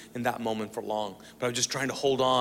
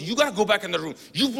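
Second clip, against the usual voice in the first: raised, higher voice in a mock squabble; arguing; 6 semitones higher, 5 dB louder, faster than usual. Brother ordering him back in the room, mock arguing. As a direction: act out insistent bickering. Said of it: "We argue for".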